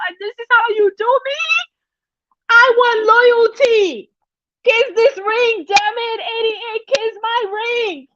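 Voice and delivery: mock queen ordering her ring kissed; silly diva voice. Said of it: "I'm just being silly".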